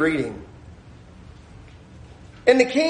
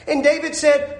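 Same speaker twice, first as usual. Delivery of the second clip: ordinary delivery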